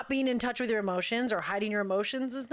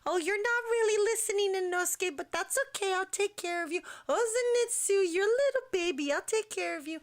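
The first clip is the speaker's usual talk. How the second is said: put-on higher, gentle big-brother voice cooing at the boys; higher tender coo to his friends; mothering Tanjiro; 7 semitones higher than usual. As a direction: play a doting mom soothing little ones. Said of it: "he's just like".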